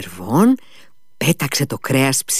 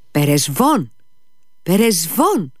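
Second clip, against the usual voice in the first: name repeated higher the second time; calling the dog; 5 semitones higher, slower than usual. A narrator's reading. Iliusha inviting the dog to his bed, eager calling. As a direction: coax the dog over, lifting the pitch. Sick boy calls the dog over.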